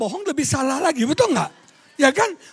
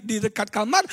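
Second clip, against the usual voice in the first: usual speaking voice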